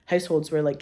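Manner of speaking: normal talking voice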